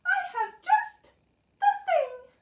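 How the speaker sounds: very high, loud little mouse; eager Mouse. A narrator's reading. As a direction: announce a remedy in a squeaky mouse voice. Mouse offers a remedy.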